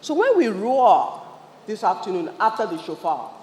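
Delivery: ordinary talk, nothing marked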